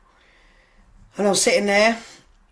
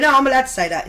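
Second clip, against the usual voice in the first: a louder, higher mock-defiant protest; retold playful outburst, higher and louder; defiant insistence; 8 semitones higher, 9 dB louder, faster than usual. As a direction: push back loudly, half joking. Insisting to boss on joking.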